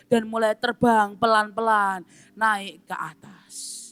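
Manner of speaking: ordinary talk, nothing marked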